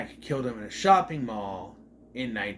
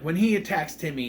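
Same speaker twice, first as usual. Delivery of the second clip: ordinary talk, nothing marked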